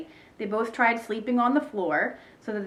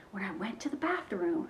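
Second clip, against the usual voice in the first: much softer, secretive tone; confiding whisper; 9 dB quieter than usual. A narrator's reading. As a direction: drop to a hush to share a secret. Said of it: "whispered Ivy".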